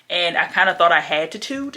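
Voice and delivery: normal talking voice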